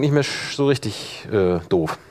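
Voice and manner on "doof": ordinary talk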